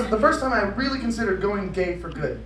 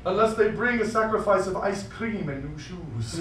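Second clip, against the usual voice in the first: epic legend narration; 5 dB louder than usual. Acted. Epic quest narration parody.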